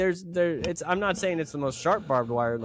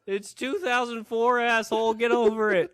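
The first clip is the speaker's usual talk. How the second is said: mock scolding; 5 semitones higher than usual. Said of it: "acted quarrel in roles".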